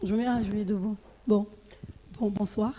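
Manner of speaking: very quiet, sore-throat voice; swollen tonsils. Real, not acted. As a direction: speak softly through swollen tonsils. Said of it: "Je parle doucement parce que j'ai une angine".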